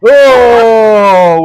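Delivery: loud burst of laughter on "oh". Real, not acted.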